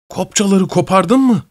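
şaşkınlıkla bağırarak; surprised cry. A narrator's reading. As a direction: blurt it out in amazement. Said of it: "şaşırarak bağırdı".

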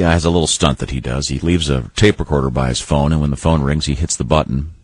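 ordinary talk

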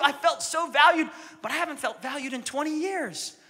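high, pleading wife voice rising as she goes; complaining wife. Acted. Wife complains to the counselor about her husband, high and pleading. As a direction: act the hurt wife pouring out grievances. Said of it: "The wife goes".

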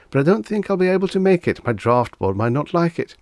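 high, fast nervous excuse. A narrator's reading. Nervous Reggie refusing.

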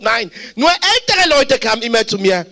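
ordinary talk

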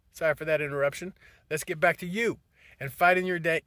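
usual speaking voice

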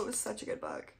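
soft, half-whispered, choked up; real teary hush over a beloved book; quietly moved, voice barely there; near tears. Real, not acted. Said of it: "I'm gonna cry again".

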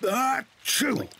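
high-pitched acted sneeze; big cartoon "achoo"; loud sneeze. Wet father sneezes.

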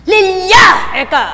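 imitated over-forceful name call; straining, worked-up shout. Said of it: "Gagalaiti pa".

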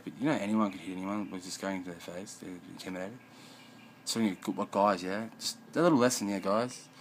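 normal talking voice

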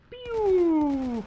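high, loud blaster pew; pew sound effect. Pretend. Gamer voicing his own gunshot, high cartoon pew. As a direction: spit out a cartoon laser shot.

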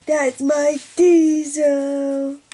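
slow, loud, drawn-out coo. Real, not acted. Showing her dog to viewers, slow loud cooing. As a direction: stretch it out, adoring the dog.

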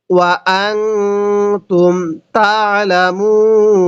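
আনতুম nasalized and hidden, slow; slow recitation with ikhfa. Shown as a demonstration. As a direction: recite ওয়া আনতুম তা'লামুন drawn out. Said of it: "তাহলে কিভাবে পড়ব?".

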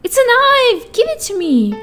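high excited shout. Real, not acted. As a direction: cry out triumphantly. Excited at finding knife.

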